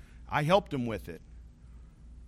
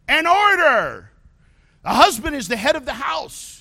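full-force preaching; 12 semitones higher, 10 dB louder than usual. Acted. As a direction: hammer it home from the pulpit. Preacher shouting home structure.

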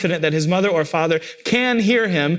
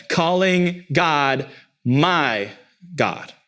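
normal talking voice

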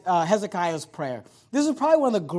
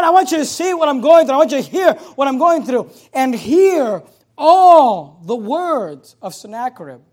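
loud, raised pulpit plea; impassioned preaching; 6 semitones higher, 10 dB louder than usual. Acted. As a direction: cry out the prayer with urgency. Preaches Hezekiah's plea with force.

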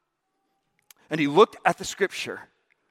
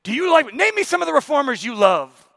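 professor; 8 semitones higher, 4 dB louder than usual. Retold or imitated. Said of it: "And he says".

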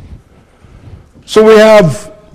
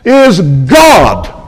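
ordinary talk, nothing marked

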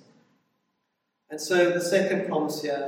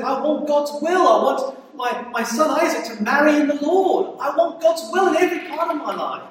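a touch of raised, impassioned pleading, higher and louder; fervent Abraham; 9 semitones higher, 5 dB louder than usual. Retold or imitated. Preacher voicing Abraham's longing for his son, raised and fervent. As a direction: voice Abraham's longing, lifted and loud. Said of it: "that's what Abraham wanted".

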